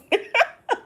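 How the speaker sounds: high-pitched cackling laugh; helpless high giggle; real laugh. Real, not acted. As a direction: dissolve into amused laughter. Laughs at the quoted banter.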